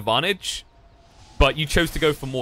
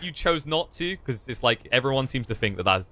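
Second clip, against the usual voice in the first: ordinary talk, nothing marked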